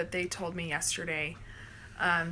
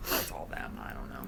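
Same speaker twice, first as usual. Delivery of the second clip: quiet modest reply; 7 dB quieter than usual. Retold or imitated. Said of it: "And I was like".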